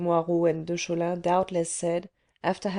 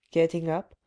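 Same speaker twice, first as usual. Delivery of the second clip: ordinary delivery, nothing marked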